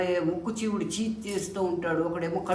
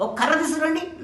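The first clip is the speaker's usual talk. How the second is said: guru ordering a stick; 10 semitones higher, 4 dB louder than usual. Acted.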